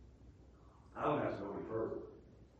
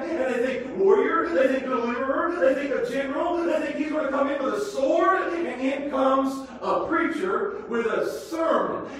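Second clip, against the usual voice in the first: loud, high, building sermon cry; preaching at full force; 13 semitones higher, 10 dB louder than usual. Acted. Preacher lifting the congregation, loud and soaring.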